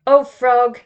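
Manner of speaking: loud, lifted princess call to the frog; high voice. A narrator's reading. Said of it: "said Jody in her high voice".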